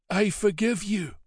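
a dying abbot holding firm; old abbot. Acted. Dying old abbot to the Viking raiders, high and frail. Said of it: "he said".

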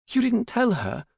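very high, alarmed daughter voice; high-pitched anxious question; alarmed demand. A narrator's reading.

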